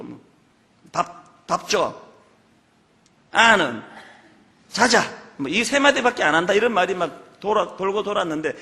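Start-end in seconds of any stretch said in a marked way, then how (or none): 0.9-2.0 lightly imitated terse Gyeongsang man demanding food; gruff Gyeongsang husband. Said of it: "집에 가면 딱 세 마디만 한다". 3.3-5.0 terse, slightly louder one-word grunts; clipped blunt mock of a taciturn man; gruff Gyeongsang husband. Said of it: "집에 가면 딱 세 마디만 한다".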